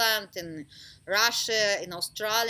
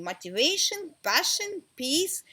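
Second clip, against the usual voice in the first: usual speaking voice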